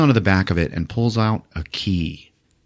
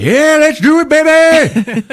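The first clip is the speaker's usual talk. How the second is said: very high, loud whoop; hyped cheer; 8 dB louder, slower than usual. Acted. Prisoners to each other, very high loud whoop. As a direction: whoop it like a rowdy gang.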